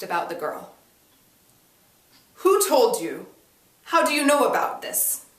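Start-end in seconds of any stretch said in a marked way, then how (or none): 2.4-5.3 how dare you ask. A narrator's reading. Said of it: "how dare you ask" tone".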